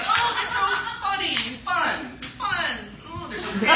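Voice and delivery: sing-song mock delight, stretched out; fawning family voice. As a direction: imitate relatives gushing in a high voice. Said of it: "my little family has to do things like".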